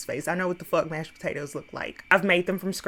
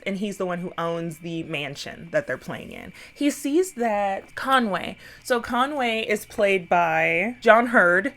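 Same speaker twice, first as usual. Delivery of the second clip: normal talking voice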